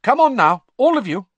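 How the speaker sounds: high, loud, firm call to order; mother ordering the squabbling children upstairs, high and loud; breaking up the argument. A narrator's reading. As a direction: cut the quarrel short with a loud command. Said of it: "breaking up the argument".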